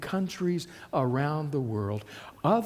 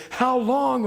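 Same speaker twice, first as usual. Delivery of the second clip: high, louder cry of how long; anguished cry; 7 semitones higher, 4 dB louder than usual. Retold or imitated. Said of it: "cried out to God and said".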